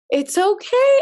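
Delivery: soothing grandfather. Retold or imitated. Grandfather soothing his crying granddaughter, high cooing voice.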